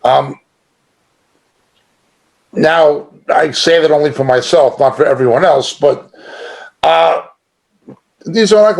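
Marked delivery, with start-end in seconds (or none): none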